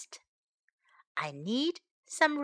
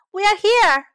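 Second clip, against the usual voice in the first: higher, louder call; 8 semitones higher, 8 dB louder than usual. Shown as a demonstration. Teacher modelling a loud answering call. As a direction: announce it loud and high. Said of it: "teacher models the line again".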